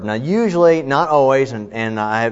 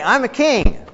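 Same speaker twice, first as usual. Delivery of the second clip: "king" said in a raised, boastful voice; proud king; 12 semitones higher, 3 dB louder, slower than usual. Pretend. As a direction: claim the crown like a proud king. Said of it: "If I say".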